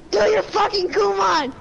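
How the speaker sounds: acted-out angry parent command; imitated yelling mom. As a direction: mimic mom ordering homework done. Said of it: "and she's like".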